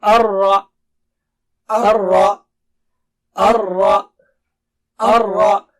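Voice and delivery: Ar-Ra with a heavy, thick ra, said loud; loud drill of Ar-Ra. Shown as a demonstration. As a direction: press the thick ra in Ar-Ra. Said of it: "Ra should be thick here".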